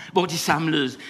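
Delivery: ordinary talk, nothing marked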